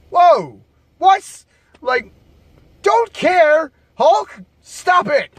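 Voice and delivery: high-pitched, disbelieving objection; acted-out startled "stop it"; shocked protest. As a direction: cut him off in high, appalled disbelief. Acts out own shocked reaction to Hulk.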